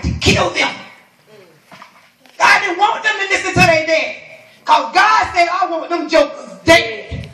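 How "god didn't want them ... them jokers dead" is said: raised, high-pitched sermon shout; forceful preaching. Real, not acted. Preacher declaring God's judgment on disobedient sons, full-force preaching.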